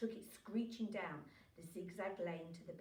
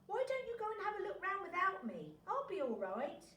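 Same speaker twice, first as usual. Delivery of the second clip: shouting; 10 semitones higher, 3 dB louder than usual. Acted. Oliver shouting back to parents.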